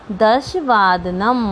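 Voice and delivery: slow, syllable by syllable दश वादनम; slow model answer. Shown as a demonstration. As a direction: spell out दश वादनम slowly for the class. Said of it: "तो हमें इसको लिखेंगे". (धीरे-धीरे अक्षर-अक्षर बोलना)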